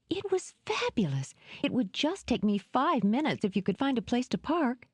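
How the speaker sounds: high, eager gushing. A narrator's reading. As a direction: plead to stop and shop. Dora gushing about the shop.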